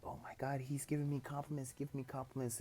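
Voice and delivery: mildly panicked, voice lifted and kept small; flustered. Acted. Girl getting compliments from Deku, lightly flustered.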